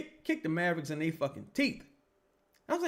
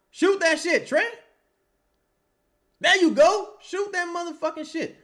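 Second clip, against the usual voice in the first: much higher and louder, hollering; yelling at the player; 9 dB louder than usual. Real, not acted. Fan urging Trey to shoot, loud holler. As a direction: holler at the TV to make him shoot.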